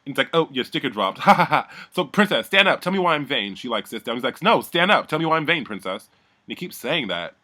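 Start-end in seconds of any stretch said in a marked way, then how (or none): 0.3-3.5 loud, high goading with fake laugh; mock taunting. Retold taunting of Isabel. 4.4-6.1 high, loud badgering; insistent mock demand. Retold insistent demand to Isabel.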